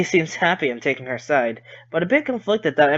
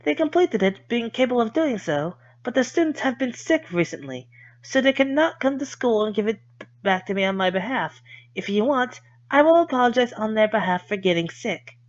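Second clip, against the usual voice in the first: put-on higher, politely cutting girl voice; sweetly pointed girl voice; Lily voice; 7 semitones higher than usual. Lily's polite jab.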